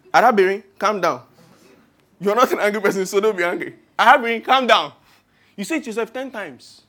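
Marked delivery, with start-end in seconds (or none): 0.1-4.9 agitated self-calming. Acted. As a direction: lose your cool while ordering yourself calm. Calming mantra growing agitated.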